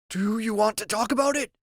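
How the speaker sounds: Grim voice. Acted. Grim asks gently.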